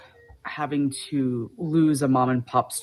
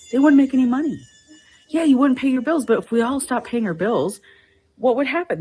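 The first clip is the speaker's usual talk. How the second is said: higher and louder, hammering the point; retold heated argument; 8 semitones higher, 4 dB louder than usual. Customer lecturing the worker about unpaid bills, heated and loud. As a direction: press him hard, half-shouting.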